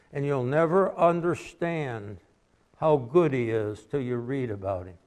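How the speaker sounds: usual speaking voice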